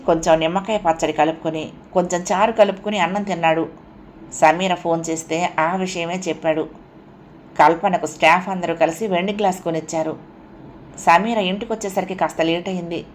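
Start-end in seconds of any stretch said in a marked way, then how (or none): none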